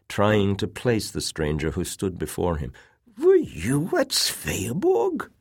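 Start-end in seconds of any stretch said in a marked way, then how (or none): 3.2-5.3 put-on slow, high weak old voice; frail old man. A narrator's reading. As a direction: quaver like an old invalid. Frail old admiral asks.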